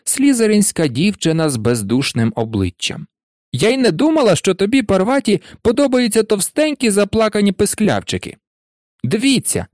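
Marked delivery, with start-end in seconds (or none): 3.5-8.4 high-pitched taunting schoolgirl; mocking. A narrator's reading. Pansy teasing Parvati about whom she likes, mocking. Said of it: "глузливо мовила Пенсі Паркінсон". 9.0-9.7 high, eager call. A narrator's reading. Malfoy calling the class to look, calling out. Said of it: "гукнув Мелфой".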